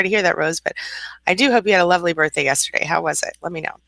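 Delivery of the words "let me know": normal talking voice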